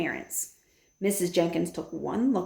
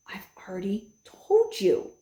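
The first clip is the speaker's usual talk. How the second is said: hushed grandmother whisper; patient whisper to the parents. A narrator's reading. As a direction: breathe it out under the voice.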